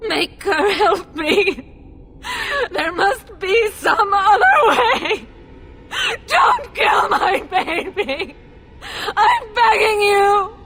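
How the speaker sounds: high, sobbing shouted plea; desperate begging. Acted. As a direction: beg loudly through tears, voice climbing. I'm begging you.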